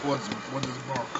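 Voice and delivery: low, soft gruff dog voice; Russian dog voice. Acted. A comedian voices a Russian dog low and gruff. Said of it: "You gotta do the voices".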